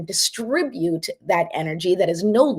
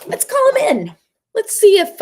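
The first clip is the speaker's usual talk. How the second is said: high, louder, drawing him in; playful calling out; 13 semitones higher, 7 dB louder than usual. Real, not acted. Host calling her late husband to help, high playful call.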